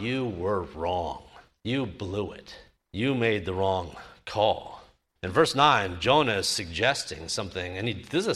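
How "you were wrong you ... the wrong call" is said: accusing God. Retold or imitated. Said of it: "to tell God".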